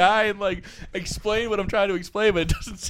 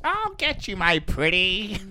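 Wicked Witch; 3 dB quieter, slower than usual. Retold or imitated. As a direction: imitate a movie witch, stretching the last words. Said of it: "That's the Wicked Witch".

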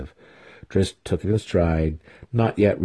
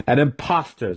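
accusing cry; 6 semitones higher than usual. A narrator's reading. Mentor denouncing his student, crying out. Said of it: "Zack cried".

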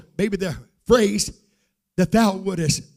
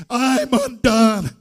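loud, raised preaching cry; exultant church shout; full-force preaching; 5 semitones higher, 6 dB louder than usual. Real, not acted. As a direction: cry out overwhelmed praise.

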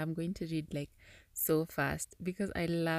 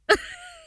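squealing laugh, much higher; helpless high giggle; high burst of laughter; 7 dB louder than usual. Real, not acted. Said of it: "I'm laughing".

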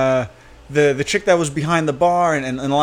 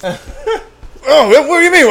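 squeaky playing dumb; 11 semitones higher, 6 dB louder, slower than usual. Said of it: "And I was like".

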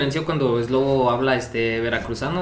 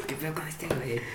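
unvoiced, soft mutter; hushed mock aside; whispered aside; 11 dB quieter than usual. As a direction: whisper it so the teacher won't hear.

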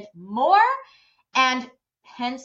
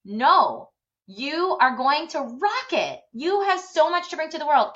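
higher, forceful retold pep talk; aggressively encouraging; 6 semitones higher, 4 dB louder than usual. Foster woman yelling belief at a girl, aggressively encouraging. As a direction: imitate someone fiercely insisting on belief. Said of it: "very, like, aggressive way".

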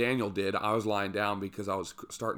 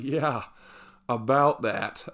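sheepish admission; 2 semitones higher, 5 dB louder, slower than usual. Retold or imitated. Admitting they had no ropes.

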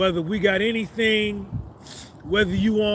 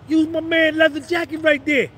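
quoting a bystander; 8 semitones higher, 4 dB louder than usual. Retold or imitated. Somebody said.

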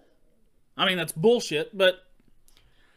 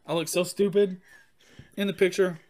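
ordinary talk

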